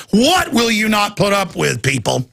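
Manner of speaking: normal talking voice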